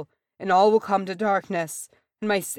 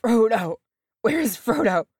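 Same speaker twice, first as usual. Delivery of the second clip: urgent, higher and louder pained cry; breath hissing through teeth; 6 semitones higher, 6 dB louder than usual. A narrator's reading. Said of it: "his breath hissing through his teeth".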